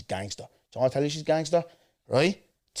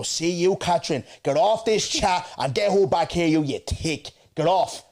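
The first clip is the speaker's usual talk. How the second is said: angry retort; 6 semitones higher, 4 dB louder than usual. Retold or imitated. Angry reply to the friend.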